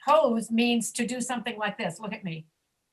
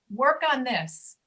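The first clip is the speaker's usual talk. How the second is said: usual speaking voice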